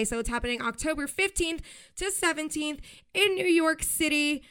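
gushing podcast host with hot gossip; excited breaking news. Real, not acted. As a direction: burst in with the big news, slowing on the headline. Announcing breaking BravoCon news.

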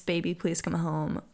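ordinary talk

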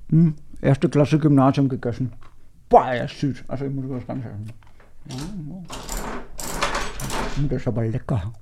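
chewing gum while talking; mouth full. Real, not acted. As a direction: talk around a wad of gum. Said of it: "Mit vollem Mund spricht man nicht".

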